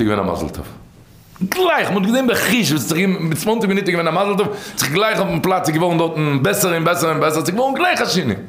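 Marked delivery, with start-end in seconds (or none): 1.4-8.4 high-pitched, animated amazement; acted excitement, leaping up in pitch; excited retelling. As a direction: tell it like a miracle happening on the spot. Storyteller acts out sudden miraculous recovery.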